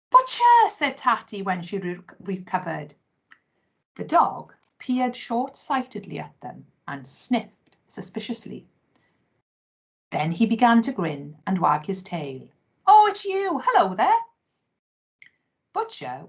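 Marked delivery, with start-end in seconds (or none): 0.1-0.7 much higher, loud startled name; startled cry. Acted. Startled, recognises the dog. 12.9-14.2 cheerful greeting. Acted. Dog greets friends.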